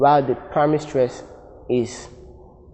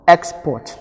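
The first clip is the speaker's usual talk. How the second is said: "export" with first syllable higher and louder; stress on first syllable; 3 semitones higher, 4 dB louder than usual. Shown as a demonstration. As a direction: hit the start of "export", EX-port, then let it fall. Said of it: "pronouncing the first syllable".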